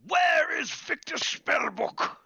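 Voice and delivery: put-on high, slow, furious old-man shout; cranky wizard shouting up high; angry old wizard. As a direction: demand it, stretching every word. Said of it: "He's like".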